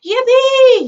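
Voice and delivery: very high, very loud joyful whoop; yell. Acted. Grandpa Joe whoops for joy, very high and loud. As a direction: explode with joy. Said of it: "he shouted".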